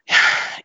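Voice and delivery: loud, breathy sigh; weary sigh. Real, not acted. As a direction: let out an audible sigh. Searching to describe today's left.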